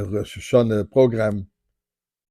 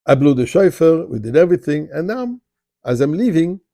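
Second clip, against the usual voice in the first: plain reading voice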